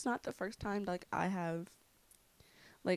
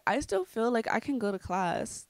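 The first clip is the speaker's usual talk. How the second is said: ordinary talk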